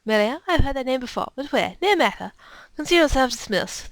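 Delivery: brusque, dismissive lady official; imperious officer with a put-on accent; lady's put-on accent. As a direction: dismiss them in an affected accent. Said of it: "It became easier to do this voice".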